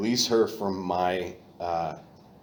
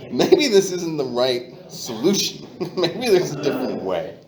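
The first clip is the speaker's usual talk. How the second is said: laughing; 8 semitones higher, 6 dB louder than usual. Retold or imitated. Laughing through the realization.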